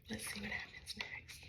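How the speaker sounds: soft breathy whisper. Pretend. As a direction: lean in and whisper it. Hushed aside to listeners.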